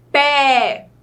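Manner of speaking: PÉ as one drawn-out syllable; demonstrated syllable. Shown as a demonstration. Teacher showing pupils one mouth opening, drawn-out PÉ. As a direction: hold PÉ in one open sound. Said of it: "para pronunciar a palavra pé?".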